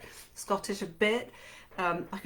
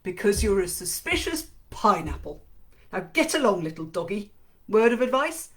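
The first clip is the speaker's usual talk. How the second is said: louder, playful friend's voice; teasing Ben; 6 dB louder than usual. Acted. Ben teasing the narrator before she rides off, playful and louder. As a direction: tease her, grinning, then send her off.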